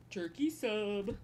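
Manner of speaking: slow, drawn-out catchphrase in a put-on voice; chicken jockey voice. Streamer addicted to a silly catchphrase, mock chicken jockey voice. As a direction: stretch it out like the chicken jockey line. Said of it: "saying stupid things in that voice".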